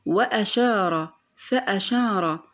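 وأشار، فأشار drawn out, glottal stop after wa and fa; slow, sound the hamza in وأشار، فأشار; hamza pronounced. Shown as a demonstration. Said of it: "لأننا نطقناها".

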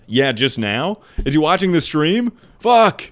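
loud, high alarmed outburst; panicked shock. Real, not acted. Streamer realising Hassan watches, yelling high and loud. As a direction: it dawns on you and you yell.

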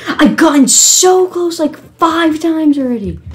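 loud, very high frustrated complaint; real high-pitched exasperation; exasperated. Real, not acted. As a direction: protest in a high, strained-with-frustration shout. Frustrated after near successes.

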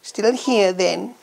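high, plummy royal voice; mock Queen greeting her husband. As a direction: put on a prim old queen's voice. Queen impression greeting Philip.

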